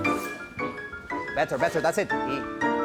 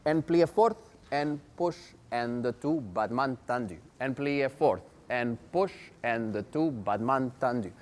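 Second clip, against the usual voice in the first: counting the steps in rhythm; rhythmic counting; 3 semitones lower than usual. Acted. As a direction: call out the ballet counts. Teacher calls the combination.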